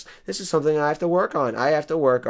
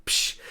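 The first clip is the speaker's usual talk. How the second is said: hissed "psh" drum sting; imitated cymbal crash; 5 dB quieter than usual. Host punctuates own zinger, mouthed cymbal crash. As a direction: mimic a cymbal after a joke. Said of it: "I need Kyle Dexter to hit the drums".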